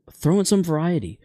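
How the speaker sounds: usual speaking voice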